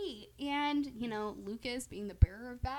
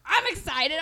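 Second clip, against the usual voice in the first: mock excitement; 4 semitones higher, 8 dB louder than usual. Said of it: "El Chapo's like".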